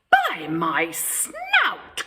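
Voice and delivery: startled cry. A narrator's reading. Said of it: "cried Horace".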